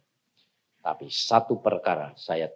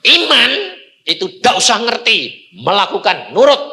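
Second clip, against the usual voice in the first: high, loud, punched declaration; forceful pulpit exhortation; emphatic preaching; 8 semitones higher, 9 dB louder than usual. Real, not acted. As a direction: drive each word home loudly. Preacher drives home point about faith.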